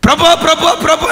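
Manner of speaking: crying out. Acted. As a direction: cry out to the Lord, weeping. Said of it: "అని ఏడుస్తా ఉన్నాడు". (ఏడుస్తూ ప్రభువును పిలిచే స్వరం)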